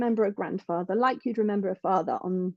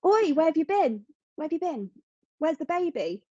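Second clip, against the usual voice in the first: retold call-out; 6 semitones higher than usual. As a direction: call out Oi like a surprised neighbour across the street. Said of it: "ran out the cafe and went".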